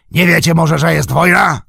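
high, very loud taunting question; orc yelling. A narrator's reading. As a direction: roar the question at full force. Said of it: "wrzeszczał".

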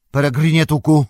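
high, loud summons; commanding call. A narrator's reading. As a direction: call him back firmly by his full name. Wizard calls hobbit back from trance.

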